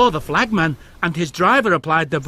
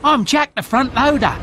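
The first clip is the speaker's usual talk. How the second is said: bright, higher proud loader voice; proud young Jack; 8 semitones higher than usual. A narrator's reading. New loader Jack introduces himself proudly to Thomas, higher proud voice. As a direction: announce yourself proudly to Thomas. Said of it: "he whirred proudly".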